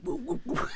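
high whooping chant; mock Cossack whoop. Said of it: "One of them's like".

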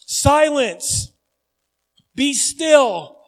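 loud, firm order to the storm; commanding call. Retold or imitated. Jesus rebuking the storm, firm loud command. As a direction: command the waves to stop. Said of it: "you say".

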